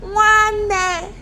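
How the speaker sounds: loud, high, long-held shout. Shown as a demonstration. Teacher asks pupils to shout it, loud shout. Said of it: "Can you shout it for me?".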